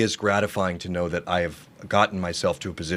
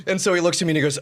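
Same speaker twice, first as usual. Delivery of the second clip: ordinary talk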